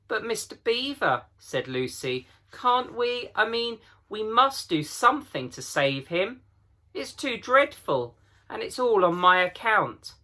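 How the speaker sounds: put-on a little girl begging to save her friend; high, urgent child's plea; Lucy pleading. A narrator's reading.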